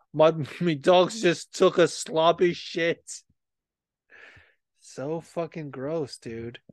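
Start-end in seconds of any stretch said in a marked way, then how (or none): 0.1-3.0 slow, laughing put-on voice; mock quote. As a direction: drag it out amused. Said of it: "he was like".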